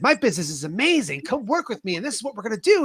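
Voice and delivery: high, bubbly mock sales pitch; fake on-camera enthusiasm. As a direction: sell it brightly for the camera. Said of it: "turned on the cameras and said".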